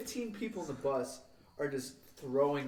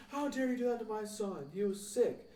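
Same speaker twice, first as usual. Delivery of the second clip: high-pitched mock scolding; put-on higher parent voice; outraged mom; 9 semitones higher than usual. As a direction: imitate an indignant mother on the phone. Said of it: "and his mom".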